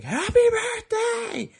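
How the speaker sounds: high-pitched, drawn-out squeal; excited birthday cheer. Retold or imitated. Waking boyfriend at midnight for his birthday, excited squeal.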